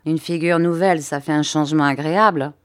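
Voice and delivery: plain reading voice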